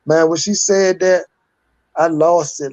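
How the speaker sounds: ordinary talk, nothing marked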